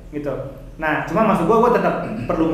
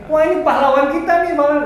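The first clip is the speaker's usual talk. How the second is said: imitated admiring onlooker; 8 semitones higher, 3 dB louder than usual. Imitates admiring resident buying petrol.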